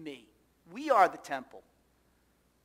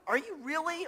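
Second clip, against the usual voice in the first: incredulous challenge; 11 semitones higher, 4 dB louder than usual. Retold or imitated. Jesus confronting the temple vendors.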